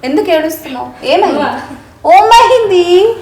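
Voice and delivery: high, loud worried questions; startled, anxious asking; alarmed concern. Real, not acted. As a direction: rush in asking why she cries. Child suddenly starts crying.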